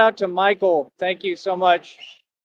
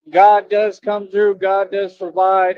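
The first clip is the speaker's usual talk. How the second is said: slow, louder testimony; preachy praise; 4 semitones higher, 4 dB louder, slower than usual. Real, not acted. Streamer praising God slowly, like preaching. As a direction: proclaim it slowly like a sermon.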